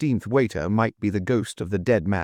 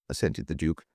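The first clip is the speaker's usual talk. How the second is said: ordinary delivery, nothing marked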